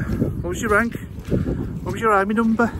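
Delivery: high-pitched Scouse interrogator; put-on Scouse accent. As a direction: fire off questions in mock Scouse. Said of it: "I'm putting a Scouse accent".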